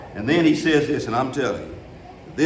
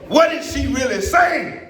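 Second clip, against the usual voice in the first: fired-up pulpit shout; preaching at full force; 11 semitones higher than usual. Acted. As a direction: preach it out to the whole church. Preacher's closing climax.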